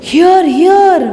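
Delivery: high-pitched courtiers' call; approving courtly chorus, read aloud; courtiers' acclaim. Acted. Courtiers cheering the king in the play.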